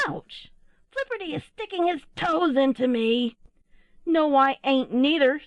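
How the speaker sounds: high, squabbling youngster voices; little owls crying out. A narrator's reading. Owlets squabbling in the nest, crying out. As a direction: squeal the complaint, high and loud. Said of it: "heard a voice cry out".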